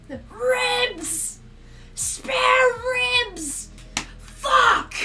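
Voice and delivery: high, loud yelled punchline; imitating video shout. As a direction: scream the answer like the video. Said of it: "that video".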